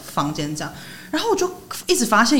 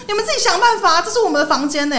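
retold retort; 9 semitones higher than usual. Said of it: "我就说".

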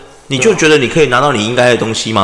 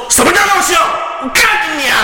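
swearing shout, pitched much higher; furious shout; 13 semitones higher, faster than usual. Retold or imitated. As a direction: explode at the old man. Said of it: "我就真的发飙".

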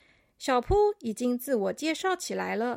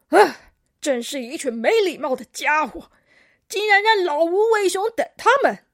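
put-on loud, cranky old grandpa; huffy, emphatic elder's grumbling; scolding old koala; 3 semitones higher, 10 dB louder than usual. A narrator's reading. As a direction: berate the youngsters with loud indignation. Grumpy old koala scolds latecomers.

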